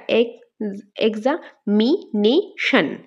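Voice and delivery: এক্সামিনেশন very slowly, এক্সা, মি, নে, শন; full pause after each part of এক্সামিনেশন; split into syllables. Shown as a demonstration. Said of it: "কয়টা হলো?". (এক্সামিনেশন চার ভাগে থেমে থেমে, খুব ধীরে)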